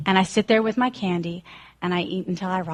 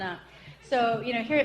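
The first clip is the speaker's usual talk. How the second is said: ordinary talk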